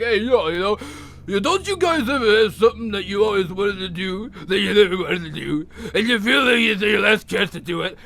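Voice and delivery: lightly voiced Rocky impression; a hint of Rocky's mumbling plea; Rocky pleading. Rocky pleading to the boxing tribunal, light impression. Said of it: "And he was like".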